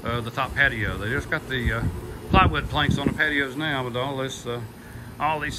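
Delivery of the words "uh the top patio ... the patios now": normal talking voice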